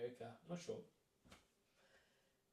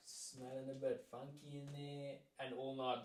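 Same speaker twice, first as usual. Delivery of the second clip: ordinary talk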